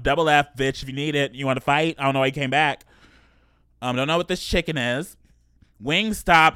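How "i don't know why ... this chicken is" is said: ordinary talk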